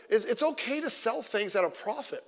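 usual speaking voice

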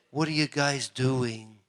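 understated, drawn-out imagined question; subdued question. Pretend. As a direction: ask it slowly and softly, as imagined speech. God imagined asking, first subdued take.

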